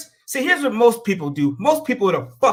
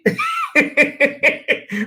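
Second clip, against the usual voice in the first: laughing out; 7 semitones higher than usual. Real, not acted. To the listeners after his own joke, a burst of laughter. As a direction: laugh hard at your own honky joke.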